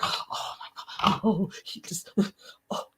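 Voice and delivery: breathless whispered shock; gasping whisper. Pretend. Acting out being swept into a story, breathless whisper. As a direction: gasp it out, caught up in the story.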